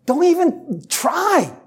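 high, slow, lightly voiced inner naysayer; discouraging inner voice. His own mind talking him out of the chore, high and slow. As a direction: drag it out like a lazy voice in your head. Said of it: "my mind says".

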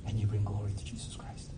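soft whispered close. Real, not acted. Pastor closing his sermon to the church, whispered. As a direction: let the last line fall to a whisper.

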